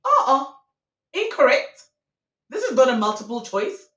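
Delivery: loud, high, indignant correction; emphatic rejection. Acted. Storyteller rejects the cheater's answer, loudly indignant. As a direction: shut it down like an outraged judge.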